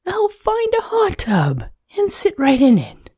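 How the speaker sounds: a hint of a cold little snowman; shivery snowman. A narrator's reading.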